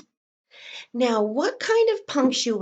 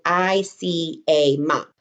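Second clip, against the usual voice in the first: slow dictation; 4 semitones lower, slower than usual. Shown as a demonstration. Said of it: "We're gonna do".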